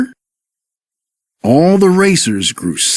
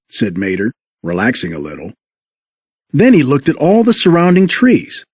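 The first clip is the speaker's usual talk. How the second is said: usual speaking voice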